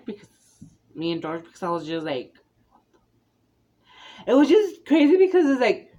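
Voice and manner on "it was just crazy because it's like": worked up. Real, not acted. Exasperated recalling scary bus scene.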